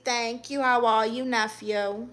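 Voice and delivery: ordinary talk, nothing marked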